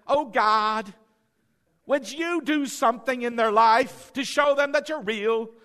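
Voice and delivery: fake religious prayer. Mock prayer over someone in pain, fake religious pleading. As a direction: put on an overblown begging prayer. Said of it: "That's a fake religious blah".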